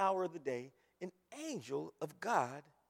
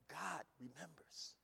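"God remembers" slow and much softer; hushed emphasis; 3 semitones lower, 14 dB quieter, slower than usual. Real, not acted. Repeated phrase, first take.

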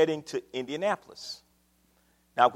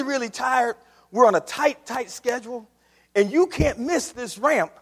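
higher, pleading, anxious boss voice; worried boss; 7 semitones higher, 4 dB louder than usual. Retold or imitated. Boss pleading with his tired driver, higher and anxious. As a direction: press him hard, higher and anxious. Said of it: "I said to the shaky navigator".

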